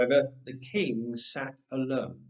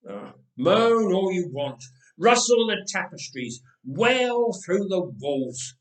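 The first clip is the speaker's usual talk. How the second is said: louder, scornful dare to the ghost; defiant taunt; 4 semitones higher, 5 dB louder than usual. Acted. Herod taunts the moaning ghost, loud defiant scorn. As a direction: throw the challenge at the walls.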